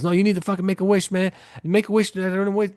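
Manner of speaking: high-pitched, worked-up insisting; agitated urging. Retold or imitated. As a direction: urge him on in a high voice. Retold argument between two friends.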